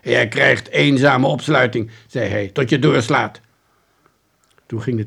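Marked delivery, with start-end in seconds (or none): 0.0-1.9 loud, stern police sentence; loud detective laying down the law; stern threat. Acted. Detective threatens the pleading suspect. 2.5-3.4 loud, stern police sentence; loud detective laying down the law; stern threat. Acted. Detective threatens the pleading suspect.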